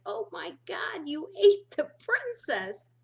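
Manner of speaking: high, loud, slowed cartoon Donkey. Viewer as Donkey, shocked at the ogre, high and loud. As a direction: squawk it like Donkey from Shrek. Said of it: "and you can be Donkey".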